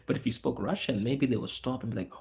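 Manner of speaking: normal talking voice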